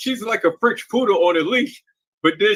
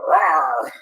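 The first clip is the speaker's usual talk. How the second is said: growl. Retold or imitated. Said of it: "sitting up there growling".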